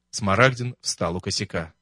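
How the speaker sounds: ordinary delivery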